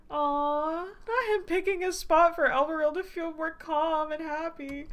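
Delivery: high, slow gushing delight; gushing aww. Real, not acted. Player gushing over a sweet character gesture, high and drawn out. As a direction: melt over the cute moment.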